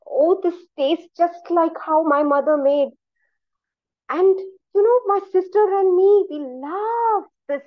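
high, excited reminiscing; delighted gushing. A narrator's reading. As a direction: gush over a taste of home. Postmaster gushes over the food.

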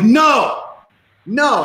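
put-on high, loud little-kid refusal; childish whine. Imitating himself refusing Andrew like a five-year-old, high and whiny. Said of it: "I acted like a little five-year-old".

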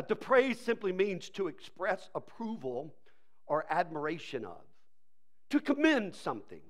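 ordinary talk

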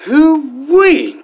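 high hollering whoop; excited whoop. Acted. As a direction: let out a big country yeehaw.

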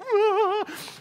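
high squealed mock sob; mimicked child's wail. Announcement drowned by the lost boy, mimicked high wail. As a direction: wail like a bawling little boy. Imitates the crying boy's wail.

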